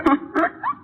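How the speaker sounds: short, high, bitter laugh. Acted. Wife laughing at her husband's worry, bitter laugh. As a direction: laugh it off with an edge.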